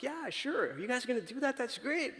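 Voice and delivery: high-pitched, delighted neighbour going along with it; imitated happy neighbour, pitched well up; delighted agreement. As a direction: act out an eager, easy yes. Acting out neighbours happily agreeing.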